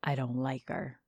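put-on low, soft grumbling old man; grumpy old man whisper. A narrator's reading. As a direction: murmur it like a cranky old farmer. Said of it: "with his best grumpy old man voice, he whispers".

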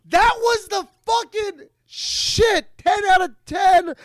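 high, loud, slow gushing praise; excited shout. Real, not acted. Host raving about the Mortal Kombat trailer, loud and high. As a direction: gush like a thrilled fan.

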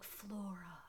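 soft, whispery imitation of longing; hushed mock-desperate call to a beloved; desperate, quiet. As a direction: breathe the name out like a lovesick plea. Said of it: "desperate and quiet".

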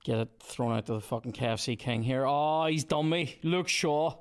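ordinary talk

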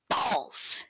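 unvoiced, half-whispered balls; Bobby impression. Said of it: "He doesn't say it like that sometimes".

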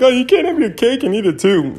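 high, far whiny mimic of critics; squeaky mocking impression of critics. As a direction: sneer it up in a squeaky voice. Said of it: "People are like".

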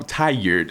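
"tired" in two syllables, r pronounced; proper pronunciation. Shown as a demonstration. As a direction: say "tired" the careful, standard way. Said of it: "that's the proper".

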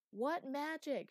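high, loud shouted demand; shouting. A narrator's reading. Chloe to the departing master, shouting. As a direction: yell the question after someone leaving. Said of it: "shouted Chloe".

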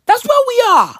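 mock-grand high tagline. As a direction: toss it off high like a slogan. Mock announcer-style tagline.

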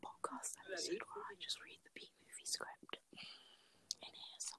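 hushed night-time whisper; breathy whisper into mic. Real, not acted. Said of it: "so I'm whispering".

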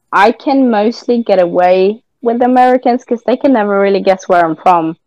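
normal talking voice

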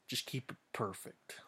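unvoiced whisper. Real, not acted. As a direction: breathe it as a secret. Whispered aside.

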